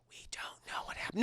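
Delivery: hushed secretive whisper. Real, not acted. Teasing hushed aside.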